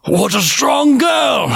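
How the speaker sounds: high, slow windy voice; a worn-out wind spirit marvelling; tired wind spirit; exhausted whirlwind. Acted. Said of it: "one of them said".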